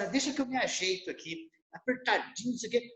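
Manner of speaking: soft, fast, accommodating murmur; meek quick mumble. Retold or imitated. Phlegmatic person quietly agreeing to squeeze in, meek quick mumble. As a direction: mumble it as you shuffle into the corner.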